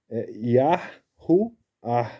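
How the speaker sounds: slow teaching demo of Yahuá, ya-hu-á; Yahuá drawn out slowly. Shown as a demonstration. Teacher spells out the name to viewers, slow and stretched. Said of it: "a pronúncia é desse jeito".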